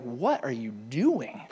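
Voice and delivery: low, slow, grumbling grown-up voice; a deadpan annoyed neighbor, drawn out; grumpy Squidward. Retold or imitated. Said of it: "he's like".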